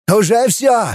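high, excited cry; shouting. A narrator's reading. Scientist bouncing by the little screen, excited shout. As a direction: cry out that the danger is over. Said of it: "закричал Тенин".

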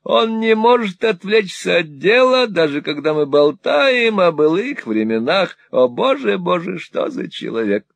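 put-on high, wheedling old miser voice; fawning old moneylender. Old usurer fawning on Ralph, high and wheedling. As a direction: simper it like a cringing usurer. Gride voiced in Nickleby reading.

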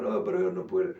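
self-pitying adult voiced deep and low; gloomy adult. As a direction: sulk low, sure you can't.

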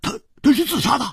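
high, fast eager interruption; cutting in eagerly. A narrator's reading. Hu Tiehua cutting Chu off, high and fast. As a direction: rush in before he finishes. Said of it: "就抢着说".

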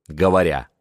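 ordinary delivery

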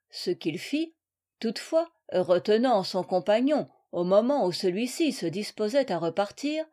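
ordinary delivery, nothing marked

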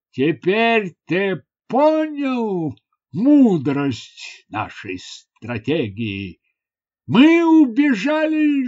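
slow, pompous chief with big pitch swings; boastful chief. Acted. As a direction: stretch it out grandly to cover embarrassment. Chief boasts to cover his cowardice. (хвастливый вождь)

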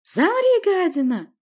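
very high, loud cry; screamed command. A narrator's reading. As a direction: scream at the foe to freeze. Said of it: "вскричала она".

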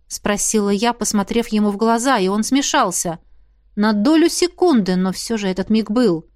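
usual speaking voice

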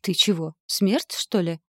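ordinary delivery, nothing marked

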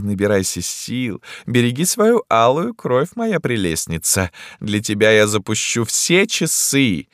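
affected ruler's declamation; grandiose emperor. A narrator's reading. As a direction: bid a florid, cooing farewell. Emperor figure taking leave.

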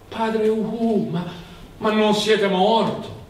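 alto e lento, incredulo davanti a un morto; sorpresa incredula. A narrator's reading. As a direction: chiama stupito il prete che credeva morto. Zosimo stupito vede il morto. (Zosimo sbalordito chiama padre Uhu, acuto e lento)

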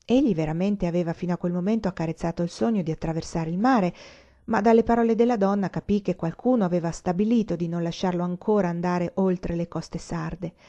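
plain reading voice

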